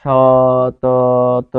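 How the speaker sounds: সতত with the vowels drawn out very long; stretched vowels. Shown as a demonstration. Teacher stretches সতত to show the open syllables. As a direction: drag out every vowel in সতত. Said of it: "তাকে টেনে, লম্বা করা যাবে".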